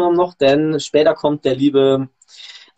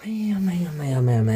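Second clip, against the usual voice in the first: whispered lament; 7 dB quieter than usual. Real, not acted. Sighing over broken stream.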